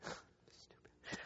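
a whispered laugh, choking up; teary whispered laugh. Real, not acted. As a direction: laugh at himself under his breath, near tears. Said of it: "I'm gonna cry over hamburgers".

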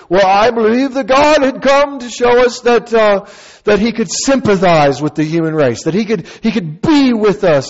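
lightly voiced pious pastor; earnest pastor. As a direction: play the keen pastor showing off. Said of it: "The next guy goes".

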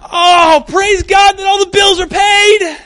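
high, loud, slow sarcastic gushing; mock cheerful praise. Mock praise after hitting a finger, high and hammed-up. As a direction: ham up absurd cheerful praise. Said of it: "you didn't hit your finger and go".

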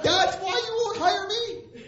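high, loud, drawn-out disbelief; hammed-up incredulous question, voice way up; incredulous outburst. Preacher questions boss about the refusal, incredulous. Incredulous reply in retold interview.

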